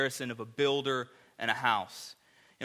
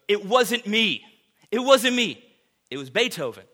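higher, louder, pleading insistence; emphatic protest; 7 semitones higher, 8 dB louder than usual. Retold or imitated. [name] to his applauding orchestra, raised pleading protest. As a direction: push the praise away, begging. [name] weeping, deflecting praise.

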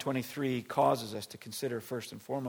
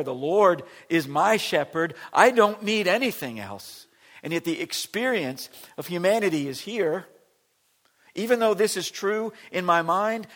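higher and louder preaching; preaching at full force; 7 semitones higher, 10 dB louder than usual. Acted. Pastor proclaiming confession to congregation, high loud preaching. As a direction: lift it up to a peak.

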